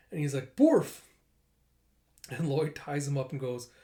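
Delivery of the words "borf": high, loud spoken woof; mock dog bark. Dog barks.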